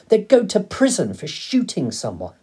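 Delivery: high, loud shocked protest; crying out. A narrator's reading. Boy arguing with his father about the keepers, crying out. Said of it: "I cried".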